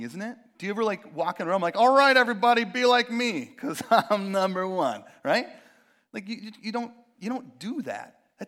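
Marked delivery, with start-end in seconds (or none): 1.8-5.0 much louder, higher, strutting boast; cocky. Retold or imitated. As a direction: brag like you're number one to the whole room. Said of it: "cocky way of talking".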